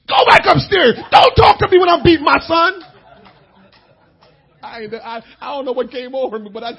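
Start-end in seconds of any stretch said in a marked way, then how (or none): 0.1-2.8 loud, high angry shout; yelling at mom. Retold or imitated. Son telling his mother to stay out of it, shouting. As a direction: bark her back up the stairs. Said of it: "I said".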